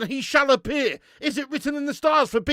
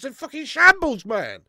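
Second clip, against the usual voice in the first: loud furious outburst; angry shout; 3 semitones higher, 7 dB louder than usual. Real, not acted. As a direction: spit it out in anger. Ranting at team.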